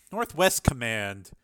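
usual speaking voice

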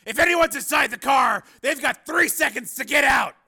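high, loud commanding shout; shouted ultimatum. Acted. Steele ordering anyone out of the car, shouted ultimatum. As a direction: bark the threat at full force.

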